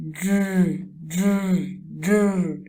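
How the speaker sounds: gentle "dr" sound demo. Shown as a demonstration. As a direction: model "dr" for the class. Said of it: "you're going to make the sound".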